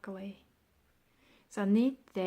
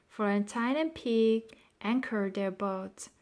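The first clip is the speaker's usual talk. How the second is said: ordinary delivery, nothing marked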